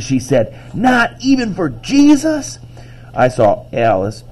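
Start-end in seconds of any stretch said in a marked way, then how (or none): 0.7-2.6 very high, slow incredulous question; jaw-dropped disbelief. A narrator's reading. Imogen shocked at no room for Jesus, incredulous. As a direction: soar up in pitch, drawn out in amazement. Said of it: "Imogen's jaw dropped".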